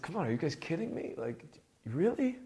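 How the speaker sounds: high-pitched, exasperated disbelief; imitating an incredulous rebuke; changed voice, incredulous. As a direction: challenge them as if they must be kidding. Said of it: "see, his voice has changed".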